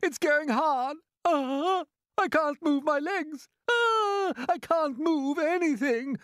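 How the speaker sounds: high, anguished wail; loud moaning. A narrator's reading. Centipede stiffens as the paint hardens, moaning in distress. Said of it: "he moaned".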